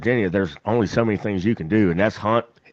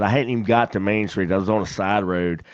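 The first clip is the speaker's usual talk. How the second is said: usual speaking voice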